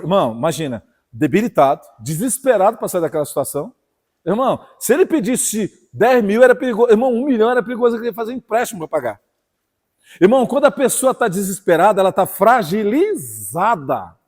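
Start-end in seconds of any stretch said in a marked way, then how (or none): 4.2-12.0 rising, forceful pulpit voice; impassioned preacher to the congregation; fiery preaching. Acted. 12.0-14.2 "fragilizada" stretched very slowly and falling; "ela tá fragilizada" spoken very slowly; drawn-out emphasis. Acted. Repeats word, drawn out.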